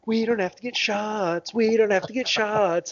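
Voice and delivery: high singsong playground taunt; gloating kids. Retold or imitated. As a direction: chant it like kids gleefully celebrating.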